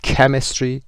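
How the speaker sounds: "Chemistry" with CH said as a hard K. Shown as a demonstration. Teacher showing students the CH sound, "Chemistry" with a K.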